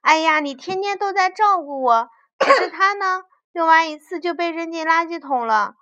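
high, loud complaining outburst; clamouring. A narrator's reading. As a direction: butt in with a noisy protest. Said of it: "佐夫在旁边嚷嚷地说".

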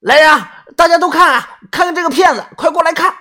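very high, loud shout to the crowd; summoning the crowd at full voice; loud calling out. Acted. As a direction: yell for everyone to come look. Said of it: "冲着周围大喊".

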